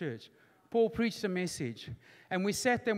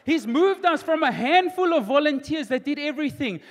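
loud, high, triumphant testimony; full-force preaching; 6 semitones higher, 8 dB louder than usual. Real, not acted. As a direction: proclaim seven years of growth to the congregation.

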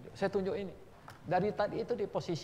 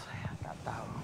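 hushed aside; 5 semitones lower, 7 dB quieter than usual. Real, not acted.

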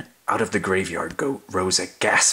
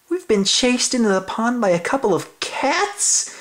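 embarrassed dogs; 13 semitones higher than usual. Acted. Dogs realize their embarrassment.

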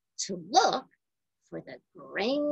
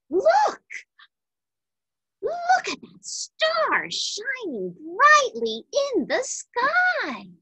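high, bright exclamation of wonder; excited; 14 semitones higher than usual. Acted. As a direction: call out in amazed delight at the star. Wise men spot new star.

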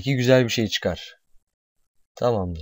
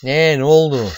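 loud, raised, surprised outburst; startled question; 4 semitones higher, 8 dB louder than usual. Real, not acted. Player confused by sudden game event, loud startled question.

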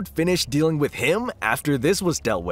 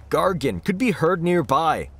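plain reading voice

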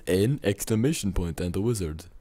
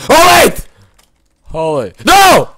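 high loud panicked cry; frantic yelled no; panicked shout; 12 dB louder than usual. Real, not acted. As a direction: shriek as it goes wrong. Another explosion.

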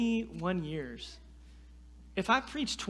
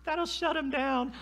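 smug laugh; 10 semitones higher than usual. Retold or imitated. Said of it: "I'm like".

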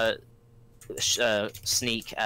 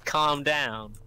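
ordinary delivery

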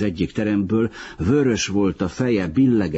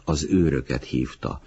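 plain reading voice

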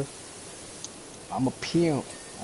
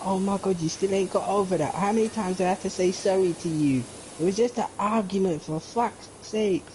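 put-on higher, fed-up girlfriend voice, slowing at the end; imitating exasperated girlfriend; 6 semitones higher than usual. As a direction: whine it like a girlfriend sick of apologising. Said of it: "She's like".

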